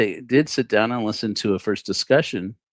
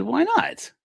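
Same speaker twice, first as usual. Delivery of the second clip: incredulous why not. Retold or imitated. Retold asking again.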